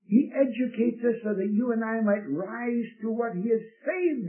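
high-pitched, exhorting pulpit voice; fervent preaching. Acted. Preacher urging listeners to enjoy heaven now, fervent exhortation.

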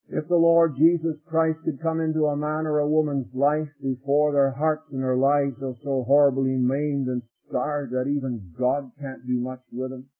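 ordinary delivery

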